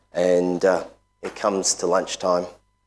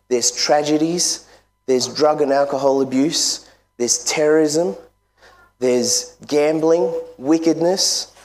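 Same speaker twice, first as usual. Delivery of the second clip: rising, higher preaching list; 7 semitones higher than usual. Real, not acted. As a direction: build the fire, sin by sin.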